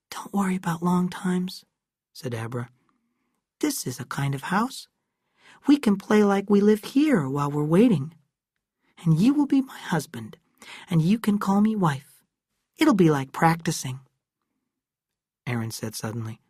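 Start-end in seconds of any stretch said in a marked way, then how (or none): 0.1-1.6 put-on hushed girl's whisper. A narrator's reading. Abra in whisper. 3.6-14.0 put-on higher playful girl's voice; a girl inventing a game of house; young girl Abra. A narrator's reading. Abra voiced in read story.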